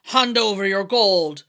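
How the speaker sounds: a loud put-on fierceness; mock fierce bellow. A narrator's reading. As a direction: bellow it like a would-be bandit. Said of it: "bellowed Tim, trying to be fierce".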